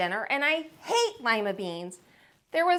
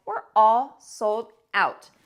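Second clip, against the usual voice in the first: slow, louder salesman voice; shoe man; 6 dB louder, slower than usual. Acted. Shoe man telling the boy his sneakers are gone, matter-of-fact. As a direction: deliver the bad news like a shopkeeper.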